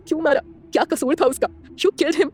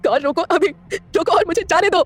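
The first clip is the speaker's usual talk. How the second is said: crying anger; 4 semitones higher, slower than usual. A narrator's reading. Heroine cries and rages at him in the car, high tearful fury. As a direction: shout through tears, slowing down. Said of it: "रोते हुए बोलती है".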